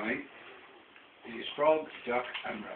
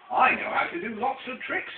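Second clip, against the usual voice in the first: boastful rabbit; 9 semitones higher, 9 dB louder than usual. Acted. Rabbit boasting in read story.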